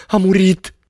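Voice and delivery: loud, very high-pitched scream. A narrator's reading. Girl bent over her unconscious fiancé, screaming. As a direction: shriek it out in horror. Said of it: "țipă ea".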